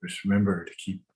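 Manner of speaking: calm, measured breathing instructions; walk them through each breath gently; guided meditation. Acted.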